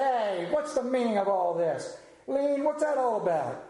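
"Lean" said as lean with a long ee, puzzled; know-it-all kid. Acted. Know-it-all boy to the conductor, lightly voiced indignant kid. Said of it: "He said".

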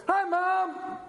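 high, bright call to mom; graduate calling out. Retold or imitated. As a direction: call out like a kid who just got the diploma. Graduate waving to the crowd.

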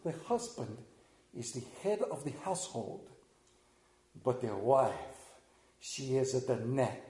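put-on a light Greek mother accent; lightly voiced Greek mother, calm and knowing. Preacher imitating the Greek mother from the movie, light accent. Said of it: "I think I can get the accent right".

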